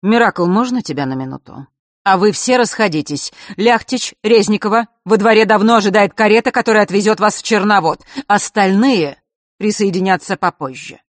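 commanding father giving orders. A narrator's reading. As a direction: take charge and dismiss the room. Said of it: "раздался властный голос Василисиного отца".

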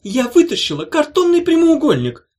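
high, loud stubborn retort. A narrator's reading. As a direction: stand your ground, defiant. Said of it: "упрямо повторила Улита".

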